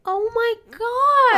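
high, drawn-out delighted gasp; excited squeal. Real, not acted. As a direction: squeal it out, slowly and loud. Gushing over cute fan comment.

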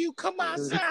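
high, soft mock mama voice; imitating a mama calling outside. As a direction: call the kids out like a mama. Voicing mama calling kids.

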